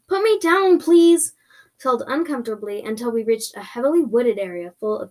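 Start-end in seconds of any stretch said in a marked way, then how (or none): 0.1-1.3 high, loud demand; screamed plea. Acted. Screaming at the creature to let go, loud plea. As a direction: yell to be released. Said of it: "I screamed at them".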